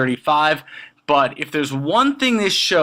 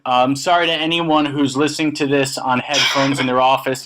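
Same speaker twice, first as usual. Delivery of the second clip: ordinary talk, nothing marked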